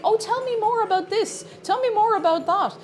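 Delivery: high, eager feigned interest; mock fascinated. Streamer retelling stalling a preacher, high mock interest. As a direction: play along sweetly to waste their time. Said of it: "I was just like".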